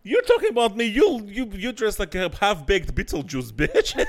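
loud, high comeback; mock roast comeback. Real, not acted. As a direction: fire the roast back, laughing. Roasting back.

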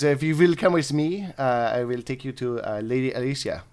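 hammed-up bad French accent; French-accented elf officer. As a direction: play an elf commander with a badly faked French accent. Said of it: "they're gonna be really bad French accents".

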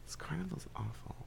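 whisper. Real, not acted. Aside complaint about carnival.

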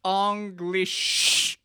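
"English" drawn out slowly as "ong-glish"; invented pronunciation. Shown as a demonstration. Inventor unveiling his new language, the name drawn out as "ong-glish". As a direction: say "English" with an "ong" start and a long final sh. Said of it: "wrapped up in this new English".

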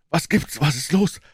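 high, breathless questions; winded, worried aunt; panting. A narrator's reading. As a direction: gasp it out anxiously. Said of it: "keuchte sie nur so".

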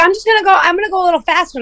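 airy, quick, high imitation of the friend; breezy friend. Friend announcing she'll speed up, mimicked high and breezy. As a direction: toss it off lightly and fast. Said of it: "She's like".

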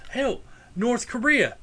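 usual speaking voice

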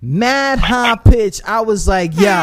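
usual speaking voice

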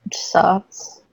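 breathy muttered whisper; whispered aside. Real, not acted. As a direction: drop to a whisper. Aside about slow healing.

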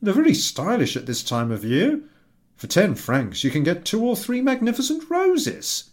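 pleading with his wife to be glad; husband's voice. Acted.

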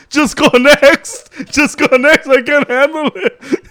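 high, loud, drawn-out plea through laughter; acting out being too overcome with laughter to cope; helpless laughing plea. Telling the photographer to skip him, helplessly laughing as he pleads. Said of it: "And I can't stop laughing".

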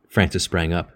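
ordinary delivery, nothing marked

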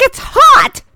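high, loud mimicked protest; complaining old lady. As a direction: squawk it like an indignant elderly passenger. Retelling the passenger's repeated complaint.

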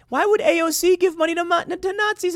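high, sarcastic disbelief; mock incredulous. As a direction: squeal the question in fake shock. Mock outrage at the claim.

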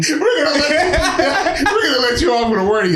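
giggling through the cop line; laughing while quoting; laughing mock cop.

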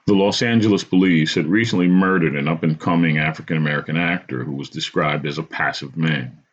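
usual speaking voice

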